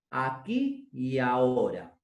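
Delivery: slow it down, each word taken apart; slowly. Shown as a demonstration. Voice teacher redoing aquí y ahora, now slowly. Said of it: "vuelvo a repetir eso muy lento".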